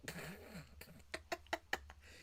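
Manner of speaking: high breathy giggle. Real, not acted. Cracking up at own joke.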